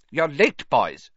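high-pitched mild scolding; reproving. A narrator's reading. Professor Flitwick greeting latecomers at the classroom, reproving. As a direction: chide them lightly. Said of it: "said Professor Flitwick reprovingly".

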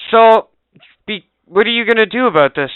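loud, high-pitched retold demand; angry confrontation. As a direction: confront the agent, acting it out. Retells confronting the delivery agent.

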